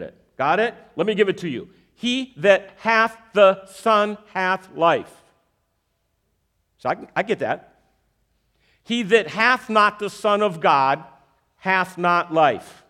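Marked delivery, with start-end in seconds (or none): 1.9-12.8 verse spelled out slowly, word by word; slow it down for a first grader; slow and simple. Shown as a demonstration. Preacher redoing the verse simply for the congregation, slow and deliberate. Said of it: "Let me do that again".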